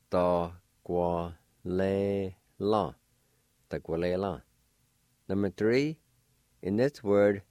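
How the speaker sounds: usual speaking voice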